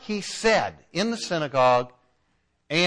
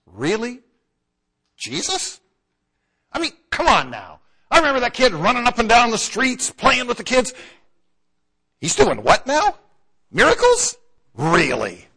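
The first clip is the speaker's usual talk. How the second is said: incredulous townsman, higher and louder, scoffing; skeptical disbelief; 6 semitones higher, 6 dB louder than usual. Retold or imitated. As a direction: scoff as if you watched him grow up and can't believe it. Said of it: "there had to be some people that were just skeptical".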